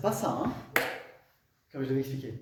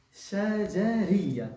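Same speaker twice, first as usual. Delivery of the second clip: higher, very drawn-out "shajariya"; slow breakdown; 5 semitones higher, 4 dB louder, much slower than usual. Shown as a demonstration. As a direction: stretch every syllable of "shajariya". Teacher breaks down the term. (décomposition très lente du mot)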